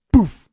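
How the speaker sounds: loud imitated bump noise; crash sound. Car bumping into car.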